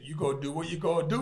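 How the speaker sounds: put-on a light Bajan accent, quick. Boxer quoting his father's Bajan reply to the threat. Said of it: "in his Bajan accent".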